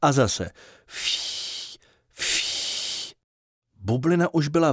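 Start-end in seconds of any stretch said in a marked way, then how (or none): 0.9-3.1 breathy blow through pursed lips, no voice; soft blowing puffs. A narrator's reading. Narrator blowing up the bubble, soft unvoiced puffs. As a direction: blow air like inflating a bubble. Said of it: "s každým dalším fouknutím".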